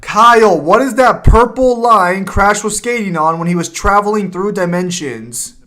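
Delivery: loud, slowing on the last words; calling out so the friend hears. Real, not acted. Repeating the question after muffled complaint.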